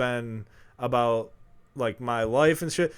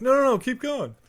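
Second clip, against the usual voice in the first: eager plea; 10 semitones higher, 8 dB louder than usual. Retold or imitated. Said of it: "I'm like".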